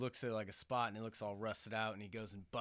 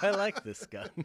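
higher, louder, laughing through it; laughing quote; 9 semitones higher, 8 dB louder than usual. Retold or imitated. Friend repeating K's line, laughing high and loud. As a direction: giggle the line out.

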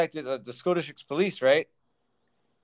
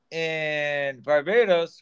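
slow drawn out; much slower than usual. Shown as a demonstration. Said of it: "oh, sorry".

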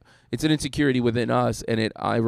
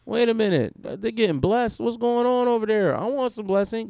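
higher, mock-whiny jealous believer; envious onlooker; 10 semitones higher than usual. Pastor mimicking people who envy others' blessings, higher mock whine. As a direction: play the greedy neighbour wanting in. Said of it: "you'll see people like".

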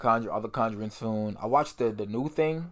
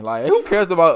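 laughing; 4 semitones higher, 11 dB louder than usual. Real, not acted. Laughing mid-rant.

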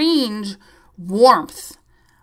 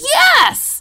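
much higher, louder eager yes; tech's yes; 7 dB louder than usual. Retold or imitated. Tech confirms to the patient, eager high yes.

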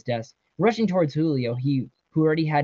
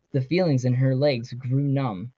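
ordinary delivery, nothing marked

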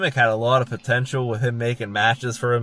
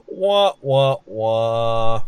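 sad trombone wah wah; 4 dB louder, much slower than usual. Pretend. Mocking fail after flubbed question.

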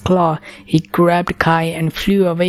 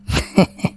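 dragon chuckle. A narrator's reading. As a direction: let out a low amused chuckle. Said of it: "The dragon chuckled".

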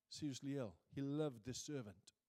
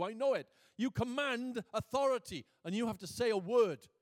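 loud, raised, driving pulpit voice; forceful preaching; 5 semitones higher, 8 dB louder than usual. Real, not acted. Preacher voicing the centurion's faith in Jesus's authority, loud and emphatic. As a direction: hammer the phrases home, building louder.

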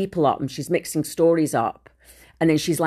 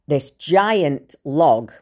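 giant stretched out very slowly and louder; stress on giant; 5 dB louder, much slower than usual. Real, not acted. Telling viewers about the log, giant drawn out slowly. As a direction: lean hard on giant, dragging it out.

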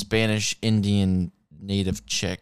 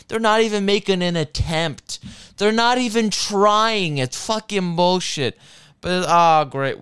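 exasperated rant; 9 semitones higher, 6 dB louder than usual. Real, not acted. Ranting about friends dawdling.

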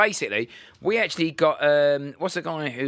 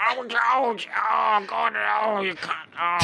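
high, gargling puppet voice; Zippy; 5 semitones higher, much slower than usual. Acted. Impressionist as Zippy moaning at George, high and gargled. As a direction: talk as if gargling a mouthful of water. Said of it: "sounds like you got a mouthful of water".

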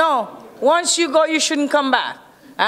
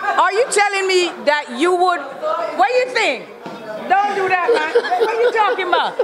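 higher, incredulous, with laughter; amused disbelief; 4 semitones higher than usual. Real, not acted. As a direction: protest it, half laughing in disbelief. Indignant laughing reaction to explicit question.

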